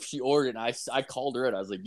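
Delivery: normal talking voice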